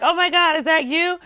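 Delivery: high and loud, mock-thrilled fan; excited fan gasp. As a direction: squeal it like a fan who just spotted you. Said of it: "everyone's like".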